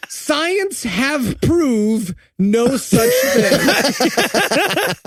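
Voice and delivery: drawn out very slowly, pitched high; deliberate line quote. Retold or imitated. Host quoting her real line, slow mocking delivery. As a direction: spell out the botched line word by word. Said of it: "this is a real line".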